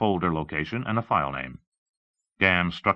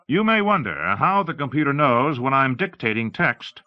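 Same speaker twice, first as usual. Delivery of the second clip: higher, louder explainer; lightly voiced demonstrator; 8 semitones higher, 5 dB louder than usual. Explaining how the computer tells commands apart, emphatic presenter. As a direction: address the audience with emphasis.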